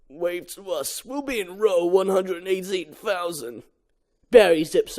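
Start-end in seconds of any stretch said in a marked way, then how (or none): 0.1-3.6 father voiced. Father to Barry.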